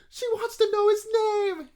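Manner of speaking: very high, loud excited squeal; giddy squeal. Retold or imitated. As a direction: freak out with joy. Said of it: "and I'm like".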